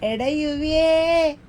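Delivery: long-drawn hailing call; playful slow summons. Pretend. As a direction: draw the name out to reach someone away. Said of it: "യുവിയെ വിളിക്കും".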